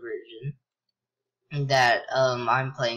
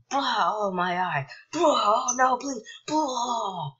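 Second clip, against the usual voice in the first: high-pitched hammed-up crying in pain; mock wailing; 9 semitones higher, slower than usual. Player mimics the crying guy who is hurting, mock wailing. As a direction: wail like a cartoon victim, higher.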